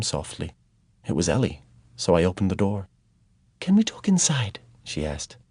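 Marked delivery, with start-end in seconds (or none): 3.6-4.6 higher whisper. A narrator's reading. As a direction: whisper so the baby sleeps. Ellie whispering at night door.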